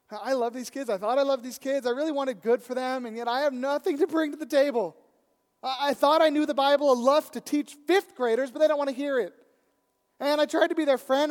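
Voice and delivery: loud, high, desperate pleading to God; anguished prayer. Retold or imitated. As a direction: pour it all out, at the end of your rope. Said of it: "I just prayed".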